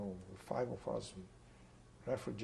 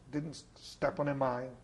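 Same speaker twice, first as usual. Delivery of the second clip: ordinary delivery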